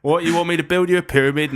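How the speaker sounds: quick Cockney patter, dropping at the end; Cockney accent. Pretend. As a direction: play a Cockney builder taking a job. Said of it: "a Cockney accent".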